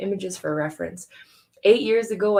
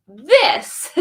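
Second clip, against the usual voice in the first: loud, high, triumphant exclamation; excited shout; 5 semitones higher, 10 dB louder than usual. Acted. Boy proudly shouting his answer to the teacher.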